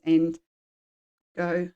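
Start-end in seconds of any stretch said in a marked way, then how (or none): none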